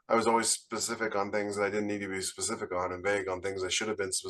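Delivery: normal talking voice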